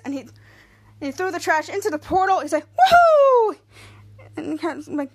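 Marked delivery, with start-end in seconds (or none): 2.7-3.6 very high, loud drawn-out woohoo; gleeful whoop. Retold or imitated. Throwing trash into the portal, loud gleeful whoop. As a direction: let out a long whoop.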